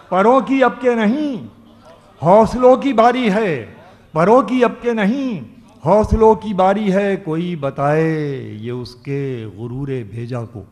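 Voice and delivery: loud stage declamation of a couplet; ghazal recitation. Acted. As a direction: ring out the verse to the hall. Poet recites verse at mushaira.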